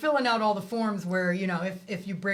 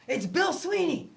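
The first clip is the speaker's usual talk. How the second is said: surprised; 10 semitones higher, slower than usual. Retold or imitated. Surprised at caller.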